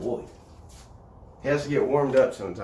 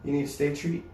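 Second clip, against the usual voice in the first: ordinary delivery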